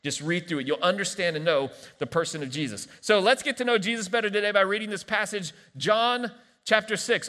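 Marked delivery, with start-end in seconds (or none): none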